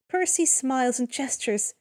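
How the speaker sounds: usual speaking voice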